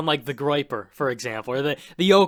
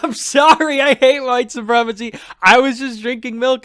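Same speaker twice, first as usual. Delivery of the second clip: high, louder mock groveling; pleading mock apology, pitched up; 9 semitones higher, 6 dB louder than usual. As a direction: ham up a panicked apology. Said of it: "And you're like".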